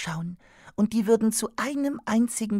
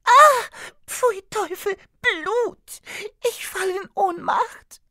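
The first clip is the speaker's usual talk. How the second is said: much higher, loud disgusted shriek; 11 semitones higher, 6 dB louder, slower than usual. Acted. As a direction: shriek at the sight of blood. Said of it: "kreischte Marzelka".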